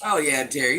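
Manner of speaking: normal talking voice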